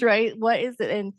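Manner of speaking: ordinary talk, nothing marked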